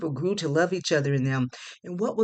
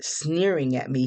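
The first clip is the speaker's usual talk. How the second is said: ordinary delivery, nothing marked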